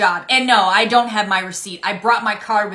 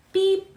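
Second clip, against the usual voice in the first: mock scanner beep; 10 semitones higher than usual. Cashier voicing scanner.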